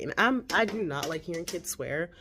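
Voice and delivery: usual speaking voice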